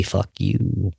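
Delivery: low, quiet, menacing inner voice; a dark voice hissing low and soft; hostile voice in head. Retold or imitated. Voice inside his head cursing at him, low and menacing. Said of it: "my head say".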